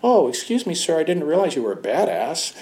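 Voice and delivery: high, hammed-up grovelling, rising to a squeak at the end; mock polite apology. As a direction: play the sarcastic, overly polite bow-out. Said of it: "the guy didn't go".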